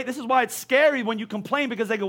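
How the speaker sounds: ordinary talk, nothing marked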